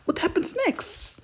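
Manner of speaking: high, loud and excited; eager begging. Acted. Listener pleading to hear what happens next, high and loud.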